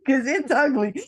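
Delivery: laughing through the words; giggling it out, a little embarrassed; high laughing aside. Real, not acted. Laughing about ugly crying on camera.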